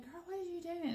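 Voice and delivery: high, far-off mock voice of a friend; playful high friend voice; teasing friend. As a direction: pitch it way up like a teasing girlfriend. Friend's text voiced in story.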